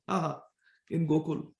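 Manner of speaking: normal talking voice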